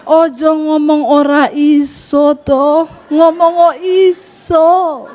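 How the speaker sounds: drawn-out Javanese coaxing; slow Solo husband. Acted. Solo husband urging his wife to learn Javanese, slow and drawn out. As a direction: stretch each phrase patiently, very slowly. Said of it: "Orang Solo kalau ngomong pelan, halus".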